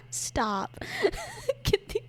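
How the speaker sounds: normal talking voice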